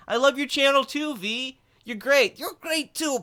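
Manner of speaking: high, hammed-up caricature voices; gushing mutual praise. Impressionist as two streamers flattering each other, high and gushing. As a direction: trade compliments in a high sing-song.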